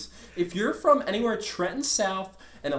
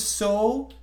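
very slow; 3 dB quieter, much slower than usual. Real, not acted. Dragged out emphasis.

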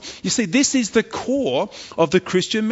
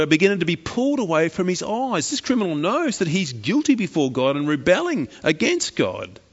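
ordinary talk

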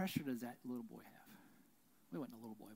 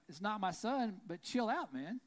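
loud, raised call back; answering the angry parent across the stands, loud and raised; 6 semitones higher, 5 dB louder than usual. Retold or imitated. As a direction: tell him to calm down, loudly. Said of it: "I was like".

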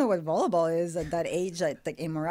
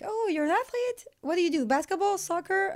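much higher curious driver voice; driver's voice; 13 semitones higher than usual. Retold or imitated. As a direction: imitate a chatty driver guessing. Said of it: "the driver will be like".

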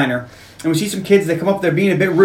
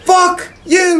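high-pitched acted outburst; defiant retort; 12 semitones higher than usual. Punk kid answers taunting coach, defiant. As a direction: spit it back as the cornered kid.

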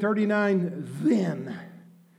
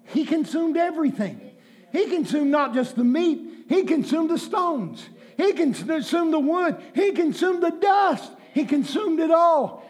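building preaching; 4 semitones higher than usual. Acted. Building list of consumed.

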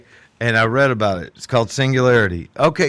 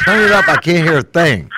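louder, pushing the words to be heard; calling out loud; 5 semitones higher, 8 dB louder than usual. Real, not acted. To the group on the call, a loud call. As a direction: call out so the far end hears.

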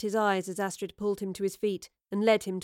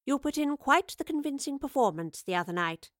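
higher-pitched, mildly gracious concession; conceding; 6 semitones higher, 3 dB louder than usual. A narrator's reading. Professor compliments the fake Bosie, conceding. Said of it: "she conceded".

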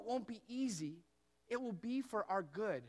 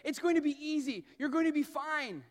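mock breezy reassurance; 6 semitones higher, 7 dB louder than usual. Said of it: "the control tower didn't say".

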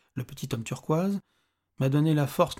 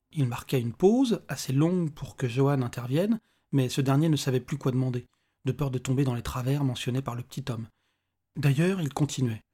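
ordinary delivery, nothing marked

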